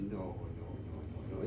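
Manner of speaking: very low, hushed denial; stunned disbelief. Acted. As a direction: murmur it in dawning dread. Minister stunned on hearing servant's name.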